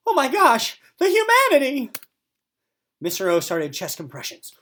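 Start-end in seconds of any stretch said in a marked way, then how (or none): 0.1-1.9 very high, loud mock shriek; melodramatic horror. Missus O shrieking at the wounded puppet, melodramatic. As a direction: ham up the tragedy. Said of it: "Missus O ran into the sunroom".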